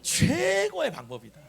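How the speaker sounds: high, loud, drawn-out proclamation; repeat-after-me call. Shown as a demonstration. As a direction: stretch 최고의 high and long. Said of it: "한번 따라합시다". (최고의를 높고 길게 끌며 선창)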